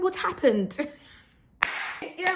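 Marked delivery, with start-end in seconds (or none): 0.0-0.8 put-on best British accent. Asking the French speakers what happened, British accent. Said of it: "my best British accent".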